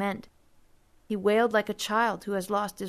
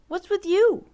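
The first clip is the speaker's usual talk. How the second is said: high, loud challenging question; probing; 12 semitones higher, 6 dB louder than usual. A narrator's reading. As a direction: poke at him to get an answer. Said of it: "he probed".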